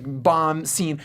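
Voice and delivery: ordinary talk, nothing marked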